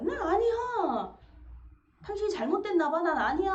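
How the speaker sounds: wife protesting to her husband, loudly; strong denial. Retold or imitated. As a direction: protest it's not you, forcefully. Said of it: "강한 부정".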